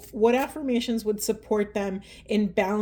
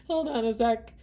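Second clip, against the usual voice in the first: softer, teary pause request; real tears; 5 dB quieter than usual. Real, not acted. Reader collecting herself through tears for the masculines. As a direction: gather yourself through crying. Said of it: "I'm, like, ugly crying right now".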